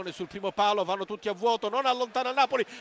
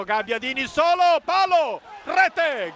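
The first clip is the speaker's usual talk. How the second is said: high, loud goal cry; goal shout; 5 semitones higher, 4 dB louder, slower than usual. Real, not acted. Goal call in live commentary.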